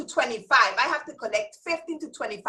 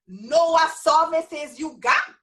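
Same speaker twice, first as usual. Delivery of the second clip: slow, louder Virgin Islands accent; 3 semitones higher, 5 dB louder, slower than usual. Real, not acted. Teacher giving her group the one rule, emphatic Virgin Islands accent. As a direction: drive it home in a drawn-out VI accent. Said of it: "That means know what services you got".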